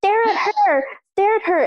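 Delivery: high-pitched imitated yelling at her; mock yell. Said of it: "yelling at me".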